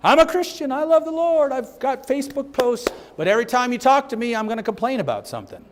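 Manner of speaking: mock a smug believer who always complains; complaining believer. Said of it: "a complaining believer".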